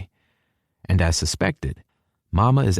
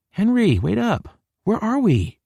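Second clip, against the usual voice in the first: put-on high, drawn-out girlish call; high female character voice; girl calling after him. A narrator's reading. Hallie calling after the narrator.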